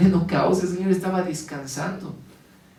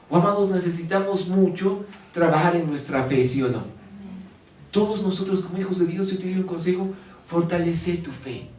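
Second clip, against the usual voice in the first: normal talking voice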